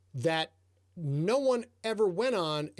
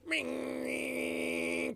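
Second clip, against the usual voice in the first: mock engine ring; 6 semitones higher, 5 dB quieter than usual. Snowmobile approaching in story.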